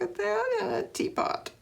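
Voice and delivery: high, soft excited squeal. Real, not acted. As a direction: squeal with delight over the teapot. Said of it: "I'm gonna squeal a lot".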